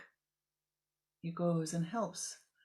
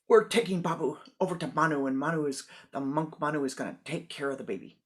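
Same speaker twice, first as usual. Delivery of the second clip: loud and fast declaration; indignant announcement; 9 dB louder, faster than usual. Acted. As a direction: storm off to the monk. Parents announce handing over baby.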